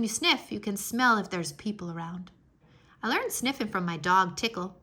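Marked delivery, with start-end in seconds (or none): none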